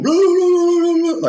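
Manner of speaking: high, loud gibberish rant, imitating the angry guy; mock foreign yelling. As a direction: shout nonsense syllables like an angry stranger. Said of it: "talking in some kind of language".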